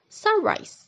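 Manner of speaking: "sunrise" with the stress on SUN; teacher's example "sunrise", first syllable stressed. Shown as a demonstration. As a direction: lean on "sun" in "sunrise", letting "rise" follow unstressed. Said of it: "The stress is on the first syllable".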